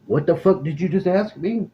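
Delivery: high, incredulous what; stunned by the question, pitch shooting up; high shocked outrage; shocked disbelief. Retold or imitated. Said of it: "I was like".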